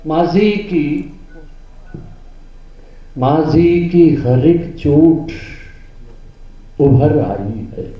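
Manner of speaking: slow, drawn-out verse, rising high and loud; mushaira recitation. Acted. Poet reciting his rubai to the gathering, declamatory. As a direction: declaim the couplet, stretching and repeating each phrase.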